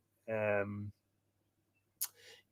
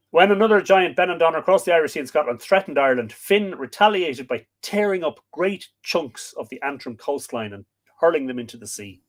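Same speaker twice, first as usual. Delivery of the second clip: louder, quicker dramatic storytelling; excited story narration; 5 semitones higher, 5 dB louder than usual. A narrator's reading. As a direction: build the giant's fight for the audience. Reads myth narration aloud.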